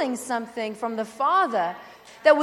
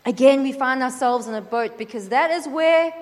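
ordinary talk

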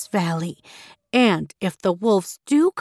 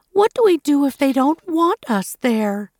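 higher, mildly anxious goat; storybook worry, pitched up; worried question; 6 semitones higher, 4 dB louder than usual. A narrator's reading. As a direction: ask with a touch of worry. Worried young goat questions grandmother.

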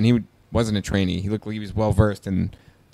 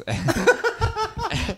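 short high-pitched laugh; high burst of laughter; 5 dB quieter than usual. Real, not acted. Laughing while retelling the Dairy Queen standoff, high burst of laughter. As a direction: laugh up high through the nose.

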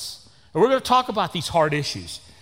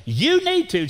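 scolding lady; 12 semitones higher, slower than usual. Retold or imitated. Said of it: "come up to preachers and say".

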